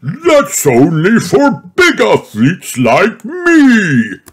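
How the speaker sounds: high, slow boastful chuckling voice; laughing. A narrator's reading. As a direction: brag through a laugh. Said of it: "laughs Grumpy Rabbit".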